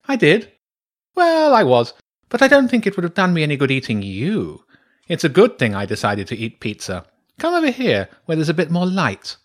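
high, emphatic badger voice; breezy badger priestess. Badger priestess coaxing a wary boy closer, breezy. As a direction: brush off the threat airily and call him over.

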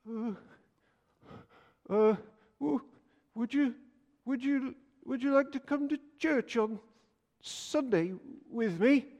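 high, soft, halting little voice, slowing at the end; a timid boy forcing the words out one by one; nervous stammer. Retold or imitated. Nervous Jack asks the man to church in a soft, high stammer.

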